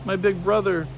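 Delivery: high, lightly tearful calling of names; a child's mild tearful wail; crying out. A narrator's reading. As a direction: call out to lost family through tears. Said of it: "crying out loud".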